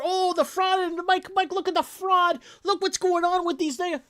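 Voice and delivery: high mocking whine of people pestering Mike about fraud; mocking complainers. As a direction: mimic the fraud-obsessed crowd nagging Mike. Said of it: "everybody goes crazy on this".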